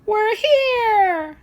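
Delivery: put-on very high, loud child voice; excited little boy. Little boy arriving at the cafe, very high and loud. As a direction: cheer it like a thrilled kid.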